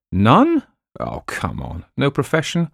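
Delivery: disbelieving, pitch leaping high; incredulous scoffing. Acted. Inspector scoffs at Jeanne.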